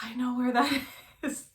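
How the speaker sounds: gasp, then high, loud exclamation; gasping, delighted re-enactment; excited recognition. Retold or imitated. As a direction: relive the thrilled discovery, laughing through it. Re-enacting excited surprise at the address.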